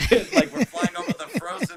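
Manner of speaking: laughing. Real, not acted. Cracking up at his own story.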